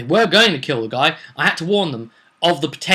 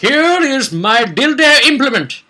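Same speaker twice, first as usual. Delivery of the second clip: higher, slow, hammed-up sleazy offer; mock lewd villain; 10 semitones higher, 7 dB louder, slower than usual. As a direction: leer it out slowly, pitched up. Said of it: "gone completely to the canon and said".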